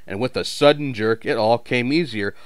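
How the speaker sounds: ordinary delivery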